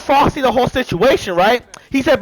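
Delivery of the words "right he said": ordinary talk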